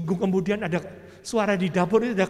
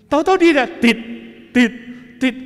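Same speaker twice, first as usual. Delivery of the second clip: higher, louder beeps as the heart picks up; high, loud imitated monitor beeps; excited monitor beeps; 8 semitones higher, 12 dB louder than usual. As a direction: beep it out in rising alarm. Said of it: "Itu nada detak jantung".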